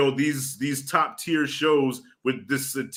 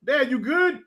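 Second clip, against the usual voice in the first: put-on higher, childlike voice; a small boy checking on his father; imitating his son; 10 semitones higher than usual. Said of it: "he's like".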